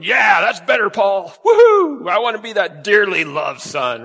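loud, high whooping celebration; excited cheering. Pretend. Pastor imagining Timothy cheering Paul's letter, loud whooping joy. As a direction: cheer it like a delighted young man. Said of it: "I almost picture Timothy going".

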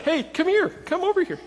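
dorky beckoning. Shown as a demonstration. Pastor showing how he'd awkwardly call someone over, high and goofy. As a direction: wave them over like a dork. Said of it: "I'd be doing it real dorky".

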